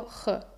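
J dicha como "je" aspirada; sonido de jota. Shown as a demonstration. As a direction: pronuncia la J como un soplo áspero, "je". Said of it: "el sonido es muy próximo a una J". (sonido aspirado de la jota)

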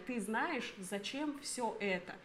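ordinary delivery

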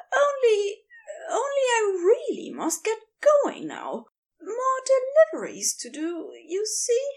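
scrambling to get away; flustered excuse. Acted.

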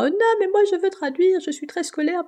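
high, mocking take on her younger stubborn self; stubborn past self. Mocking her past self who insisted on translating, high and whiny. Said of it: "moi j'étais là genre".

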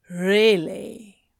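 drawn-out, teasing disbelief; sly smile. A narrator's reading. Demon teases his sceptical counterpart, sly smiling voice. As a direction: ask it through a sly smile. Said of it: "hear the sly smile in his voice".

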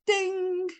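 high, sustained "ding" like a ring bell; imitated boxing-bell yell. A narrator's reading. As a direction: call the bell on one high held note.

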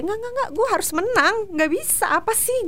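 high-pitched, defiant self pep talk; ngotot menyemangati diri. Acted. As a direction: refuse to lose, pitched well up. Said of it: "lebih ke kayak". (ngotot, menolak kalah dengan nada tinggi)

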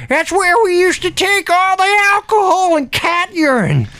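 high-pitched cranky rant; irascible old Ernie. Acted. Ernie telling Jada to shut up, cranky and high. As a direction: rant back in an excitable old-timer voice.

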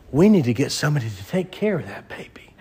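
hushed, voiceless whisper as the princess; whispered aside. Acted. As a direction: whisper it like a secret. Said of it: "And that Pharaoh's daughter says".

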